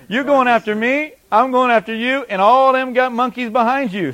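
high, loud taunt; confident threat. Acted. Preacher as David taunting Goliath and his men, confident. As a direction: throw the threat straight back at them. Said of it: "See the confidence here?".

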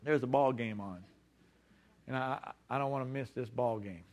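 low, sheepish mock excuse; acted reluctance, voice dropped; reluctant excuse. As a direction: make a soft, halting excuse to skip. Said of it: "you're gonna wake up and say".

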